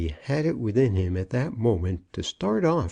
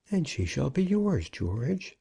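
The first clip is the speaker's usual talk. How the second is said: higher character voice, soothing; placating senior partner; 6 semitones higher than usual. A narrator's reading. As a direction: reassure the younger man in a lifted, gentle voice. Brown placates the lovesick partner.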